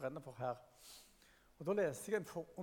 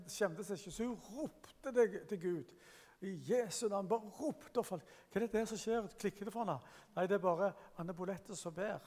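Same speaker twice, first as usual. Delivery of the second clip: raised, higher and louder, telling the story with fervour; fiery preaching; 4 semitones higher, 4 dB louder than usual. Acted. Preacher telling the church about Anne Bolette, rising and fervent. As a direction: preach it out, building excitement.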